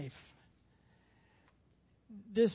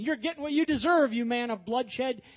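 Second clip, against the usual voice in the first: mocking taunt; 4 semitones higher, 7 dB louder than usual. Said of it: "he had some who mocked him".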